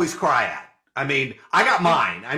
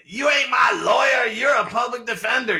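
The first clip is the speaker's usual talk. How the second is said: angry client yelling; 6 semitones higher than usual. Retold or imitated. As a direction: bark it like an offended client insisting. Client yelling at public defender.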